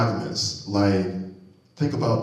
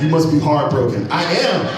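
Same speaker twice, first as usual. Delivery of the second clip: normal talking voice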